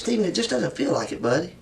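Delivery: quiet, coaxing; soft coaxing. Real, not acted. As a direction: gently press him to open up. Interrogator softens, coaxing suspect.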